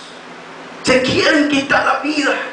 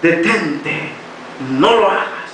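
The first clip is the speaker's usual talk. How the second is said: much lower, grave and hushed divine voice; voice of Jesus; 9 semitones lower, slower than usual. A narrator's reading. Jesus stops the man from shooting himself: low, solemn divine command. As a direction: speak it low and solemn, a gentle stop.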